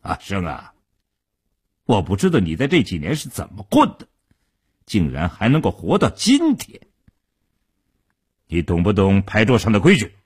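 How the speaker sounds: cold rebuke from a gang boss; chilly, controlled menace. A narrator's reading. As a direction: drop the friendliness and reprimand him icily. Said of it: "冷冷地说道".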